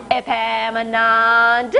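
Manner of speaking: long drawn-out call across the yard; mother calling out. Acted. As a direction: stretch the name out slowly, calling him home.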